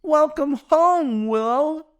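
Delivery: high, drawn-out cheery call; yelled welcome. A narrator's reading. Creatures greeting Willow at her new house, high yell. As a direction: call it out high, stretching the words. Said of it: "group of familiar voices yell".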